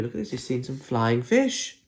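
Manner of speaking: usual speaking voice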